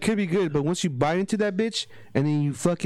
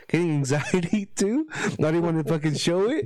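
words broken by nervous laughter; laughing nervously; 2 semitones higher than usual. Real, not acted. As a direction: laugh through it, a little embarrassed. Nervous laughing while retelling panic.

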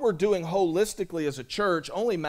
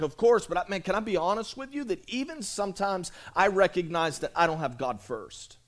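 usual speaking voice

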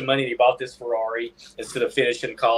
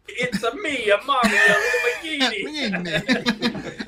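high shouted Mario voice with laughter; Mario impression; 8 semitones higher, much slower than usual. Co-host shouts a Mario impression about Lamborghini. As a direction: yell it like Mario. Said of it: "an Italian accent".

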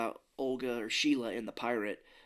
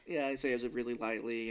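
usual speaking voice

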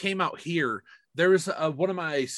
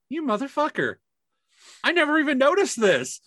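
higher, stunned affectionate cursing; amazed curse; 10 semitones higher, 4 dB louder than usual. Retold or imitated. Speaker cursing in amazement at his supervisor, higher stunned. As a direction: marvel at something you missed for years. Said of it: "Those were the words out my mouth".

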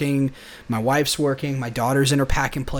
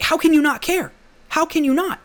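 high, disbelieving outburst, much higher; mock baffled shock, pitched way up; incredulous; 13 semitones higher, 3 dB louder than usual. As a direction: squeak out how anyone could not care. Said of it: "He's like".

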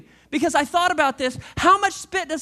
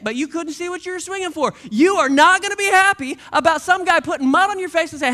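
ordinary talk

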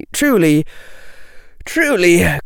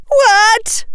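put-on very high girlish yelp; yelled; 4 dB louder than usual. Parvati reacting to Tracy's claim, incredulous yell. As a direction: yell it in disbelief. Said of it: "yelled Parvati".

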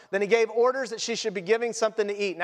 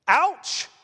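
loud, high mock ouch; pained exclamation; 6 semitones higher, 4 dB louder than usual. Preacher reacting to the cold news, loud and stung. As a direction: cry out as if stung.

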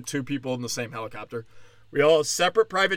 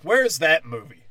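normal talking voice

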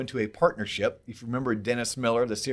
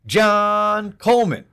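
ringmaster; 8 semitones higher, 9 dB louder, much slower than usual. Acted. Announcing cohost like a ringmaster.

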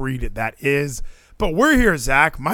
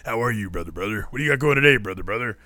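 dudes' greeting; 4 semitones lower, 3 dB quieter, faster than usual. Retold or imitated. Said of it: "all the dudes see each other".

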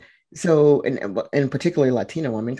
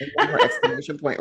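high laughing delivery; 14 semitones higher than usual. Real, not acted. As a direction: squeeze it out through a laugh. Laughing, jokey escalation.

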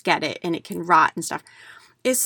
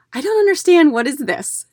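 confused woman; 8 semitones higher, 5 dB louder than usual. Retold or imitated. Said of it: "And she's like".

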